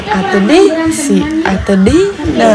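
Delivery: very slow, drawn-out names; patient reminder. Retold or imitated. Reminding the child of the aunts' names, very slowly. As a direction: stretch out each name as if teaching it. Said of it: "udah sering ingetin kan".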